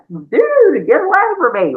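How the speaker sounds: half-laughing back-off shout; mock disgusted yell. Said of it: "You are gonna be like".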